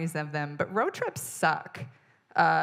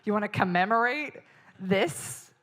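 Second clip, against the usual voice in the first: incredulous; 5 semitones higher than usual. Retold or imitated. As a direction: throw it back in disbelief. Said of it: "And I'm like".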